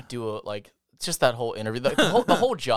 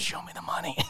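hushed, breath-only catchphrase; whispered yell; 4 semitones higher, 10 dB quieter than usual. Acted. Hostage to friend on the floor, whispering. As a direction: mime a yell under your breath.

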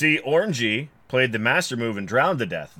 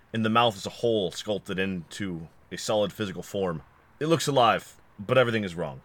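usual speaking voice

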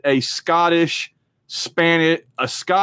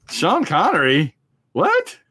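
incredulous; 2 semitones higher, slower than usual. Retold or imitated. Fan reacting to the Connery casting news, incredulous. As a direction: balk at an absurd casting choice.

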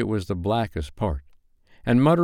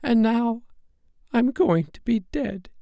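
very high fairy voice; high-pitched, fading fairy's farewell; dying fairy. Acted. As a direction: announce your death in a tiny high voice. Poisoned fairy tells of dying.

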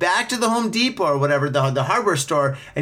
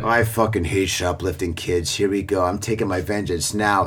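low-pitched, menacing mock monologue; vengeful shop clerk; 9 semitones lower than usual. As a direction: play a clerk taking shoplifting far too seriously. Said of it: "took it fucking way too fucking seriously".

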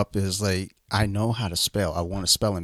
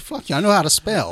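higher-pitched mock outburst at autocorrect; indignant; 10 semitones higher, 4 dB louder than usual. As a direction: snap back in mock indignation. Quotes own outburst at autocorrect.

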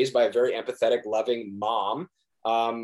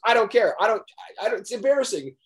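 imitated upset mom; 10 semitones higher, faster than usual. Actor retelling mom's phone call, imitated upset protest. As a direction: imitate mom insisting, pitched well up.

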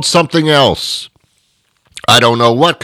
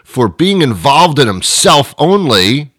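usual speaking voice

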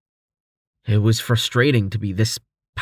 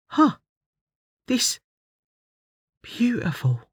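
casual; 12 semitones higher than usual. A narrator's reading. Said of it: "Ruli said casually".

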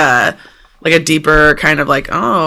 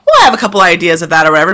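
rattled off fast; 2 semitones lower, much faster than usual. Retold or imitated. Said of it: "You're like".

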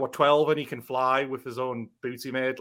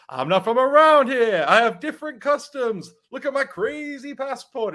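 high, loud, slow put-on foreigner voice; foreign wrestler promo; 11 semitones higher, 6 dB louder than usual. Host retelling Bender's Futurama promo, high slow mock foreigner voice. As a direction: ham up a cartoon foreign heel cutting a promo. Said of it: "he cuts that promo and goes".

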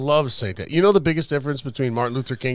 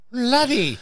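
mock Scottish; 11 semitones higher than usual. Playing with Scottish word.

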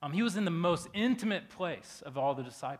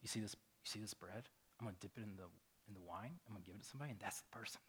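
softer and lower, sliding into a whisper; whispered secret; 5 semitones lower, 16 dB quieter, faster than usual. Retold or imitated. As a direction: tell the secret so only one hears. Jesus answering in secret.

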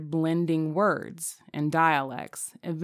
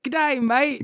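higher, hammed-up Aussie greeting; mock Australian accent; 7 semitones higher than usual. Teasing cohost about devolving into Australian, higher mock Aussie accent. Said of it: "quickly devolves into Australian".

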